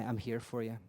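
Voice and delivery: sit with a dying person and comfort them quietly; a pastor's hushed, steady comfort; gentle reassurance. Shown as a demonstration. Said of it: "You put your hand on their shoulder and you say".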